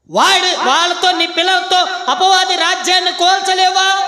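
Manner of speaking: loud, high shouted sermon; preacher rebuking parents at full volume; fiery preaching. Acted. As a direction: hammer it home to the congregation.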